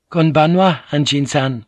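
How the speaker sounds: a timid maid greets him politely; maid's greeting. Acted. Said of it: "he replied".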